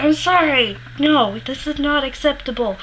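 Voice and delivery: retold indignation at having the cup taken; indignant refusal. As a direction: refuse as if it's outrageous. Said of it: "And I'm like".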